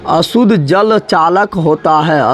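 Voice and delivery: very slow, drawn-out teacher's repeat; slow dictation. Shown as a demonstration. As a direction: spell it out word by word for the class. (धीरे-धीरे, लिखवाने के अंदाज़ में)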